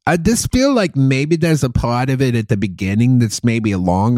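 put-on Boston accent, gushing over a film; Boston movie critic. Comic praising Sharon Stone's Casino performance, Boston movie critic accent. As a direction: rave in a thick Boston accent. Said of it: "It's like Boston movie critic".

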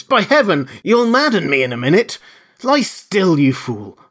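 high, loud, furious bawling; exasperated shout, at the end of patience; angry bawl. A narrator's reading. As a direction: bawl it at a struggling man. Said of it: "bawled the invisible man".

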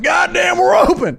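high, loud hollering "God damn, we're open"; mock Texan yell. Podcaster voicing Texas reopening, hammed-up yell. Texas voiced as a yelling state.

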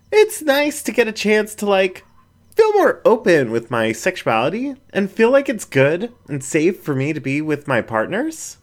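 a shy raccoon opening up; bashful raccoon speaking up; raccoon Jen, shy. A narrator's reading. Jen opens up.

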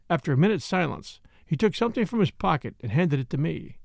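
ordinary delivery, nothing marked

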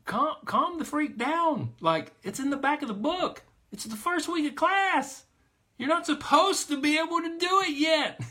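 high, loud, exasperated calm-down. Real, not acted. Coach exasperated at child's panic.

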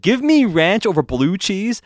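"Give me ranch over blue cheese" drawn out high and slow, mocking. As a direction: sneer it out slowly, up high. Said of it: "after he just said".

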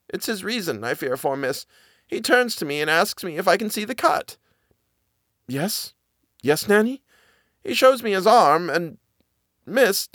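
high, halting servant voice, stumbling on the words; hesitant frightened nanny. Acted. Nanny stammers her story to Miss Genevieve, high and halting. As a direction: force out a secret she dreads telling. Nanny fears for his reason.

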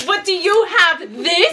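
slow, high, teasing reveal of a matching pendant; sly NPC reveal. Acted. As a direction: draw it out like a smug gotcha. GM voices NPC showing pendant.

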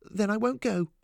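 high, impulsive outburst; exclaiming. A narrator's reading. Lillian who can't stand making mother cry, exclaiming. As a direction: burst out with it, pitch up. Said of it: "she exclaimed".

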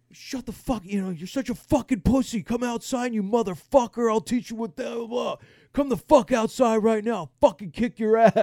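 high, loud imitation of a furious old man; mock belligerent rant; yelling old man. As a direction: mimic an old man picking a fight. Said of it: "seventy-year-old against the sixty-year-old just yelling".

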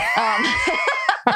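real shrieking laughter; burst of laughter. Real, not acted. Laughs at joke about her aunt.

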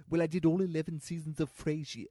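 pompous actor announcing his credits; Gil's snooty voice. Retold or imitated. An old actor boasting to a fan in a snooty voice. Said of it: "That's how he talks".

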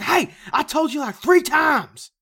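high, loud mock scolding, slow on three times; imitating an angry father yelling; fed-up dad. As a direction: scold the kids like a dad at his limit. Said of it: "he was like".

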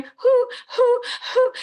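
owl-like crying hoots. Retold or imitated. Reporter imitating the bride crying at the airport, owl-like hoots. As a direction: imitate her crying hoot, hoo, hoo. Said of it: "She sounds like an owl".

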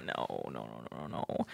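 very low, soft groaning refusal. Real, not acted. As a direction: groan it in mock disgust. Mock disgust at Fort Lauderdale.

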